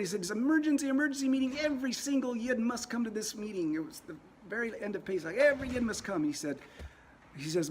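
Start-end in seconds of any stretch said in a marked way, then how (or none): 0.2-3.7 urgent summons. Retold or imitated. Rabbi calls urgent meeting. 5.3-6.2 urgent summons. Retold or imitated. Rabbi calls urgent meeting.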